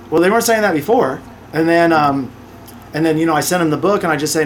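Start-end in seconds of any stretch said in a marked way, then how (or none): none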